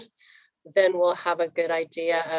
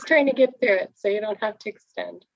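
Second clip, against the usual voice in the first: quick, higher-pitched explanation; rapid, slightly raised voice, rushing to get through; talking fast; 5 semitones higher, 3 dB louder, faster than usual. Real, not acted. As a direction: hurry through it so nobody is held up. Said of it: "I know I'm talking fast".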